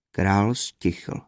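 ordinary delivery

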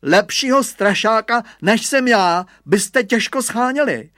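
high, loud bragging; boastful boy. A narrator's reading. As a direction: puff yourself up and crow. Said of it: "naparoval se Hugo". (chlubivě, naparovaně)

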